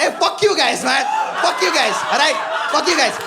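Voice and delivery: fake-angry shout, laughing; the comic shouts fake-angry at the laughing crowd; mock outrage at audience. Real, not acted.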